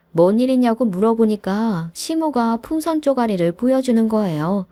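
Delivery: ordinary delivery, nothing marked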